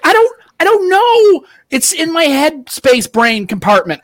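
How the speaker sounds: high, drawn-out helpless excuse; hammed-up flustered whine; flustered protest. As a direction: plead cluelessness, slow and high. Acts out flustered answer to offended man.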